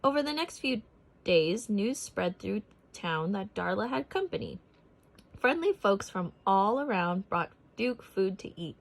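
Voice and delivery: ordinary delivery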